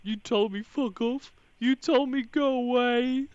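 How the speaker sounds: high-pitched whiny mimicry; mock whining. Host mimicking a caller's hurt complaint, mock whining. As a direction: whine it high, stretching the last words.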